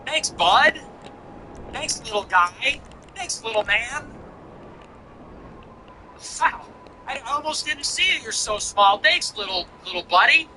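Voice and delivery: put-on high, sing-song talking down as if to a child; patronizing thanks. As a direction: pat him on the head with your voice. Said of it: "I'm teasing you".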